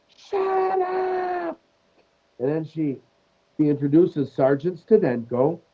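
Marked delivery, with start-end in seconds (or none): 0.1-1.6 very high squeal, held back in volume; nun's mock shriek. Sketch nun losing patience with her class, high mock shriek. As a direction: screech it high like a comic nun. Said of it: "and then this nun, she goes".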